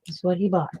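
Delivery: usual speaking voice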